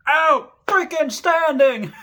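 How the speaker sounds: high, slow, loud bark; drill sergeant bark. Retold or imitated. Drill sergeant approves recruit's answer, high slow bark. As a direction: drag out the words at full volume.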